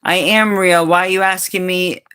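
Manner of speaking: usual speaking voice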